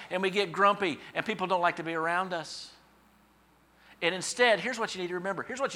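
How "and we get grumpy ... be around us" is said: ordinary delivery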